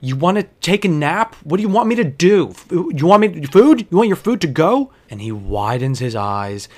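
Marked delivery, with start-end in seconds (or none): 0.0-4.9 loud, rising exasperated questions; incredulous and fed up, pitch climbing; fed-up bartender demanding answers. Retold or imitated. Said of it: "and I was like".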